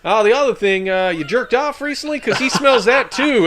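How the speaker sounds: deadpan Texan, drawn out; Texan drawl. Retold or imitated. Texan handler asks the soldier a crude question, loud and high with a slow Texan finish. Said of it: "He's like".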